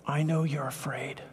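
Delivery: whispered gently, as to someone scared; soft reassuring whisper to Thomas; tender whisper. Acted. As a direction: hush it like comforting a frightened friend. Jesus comforting fearful Thomas.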